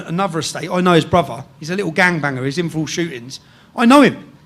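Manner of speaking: ordinary talk, nothing marked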